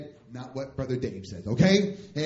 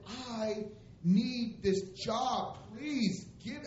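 high, drawn-out mock plea; begging; 7 semitones higher, much slower than usual. Preacher on his knees begging boss for a job, pleading. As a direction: plead slowly with pitch raised, hammed up. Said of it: "I'm begging".

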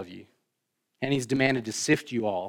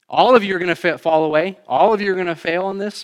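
emphatic preaching; 7 semitones higher, 9 dB louder than usual. Real, not acted. Preacher stressing everyone's failure.

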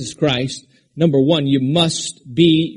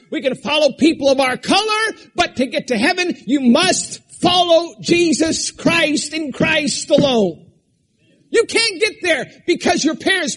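high, driving pulpit voice; full-force sermon delivery; fiery preaching; 11 semitones higher than usual. Acted. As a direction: preach it hard to the congregation.

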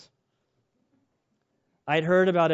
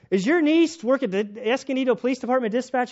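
barking questions; 8 semitones higher, 4 dB louder than usual. Retold or imitated. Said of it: "I'm barking orders".

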